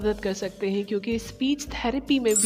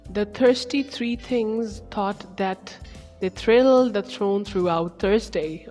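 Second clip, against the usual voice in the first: slow careful reading; slower than usual. Shown as a demonstration. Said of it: "see the difficult ones".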